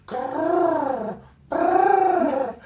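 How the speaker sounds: high little growling noise; mock tiny dog growl; imitating a growl. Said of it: "sounds like a little quail".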